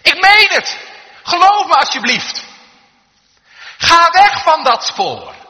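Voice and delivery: desperate full-force pleading yell; shouting back. Acted. Bystander begging the man to leave the tracks, shouting. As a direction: yell a high, urgent plea, drawing out the last order. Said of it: "riep hij terug".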